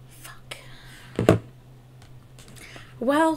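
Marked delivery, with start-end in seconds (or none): 0.1-0.6 soft unvoiced swear; whispered curse. Real, not acted. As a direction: breathe the curse under your breath. Moved by lyrics.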